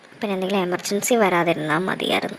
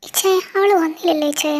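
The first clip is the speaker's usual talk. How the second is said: higher, louder anxious asking; anxious call; 6 semitones higher, 5 dB louder than usual. Acted. Mother anxiously asks her brother why her daughter is not back, higher and louder. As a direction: fret out loud for the missing daughter.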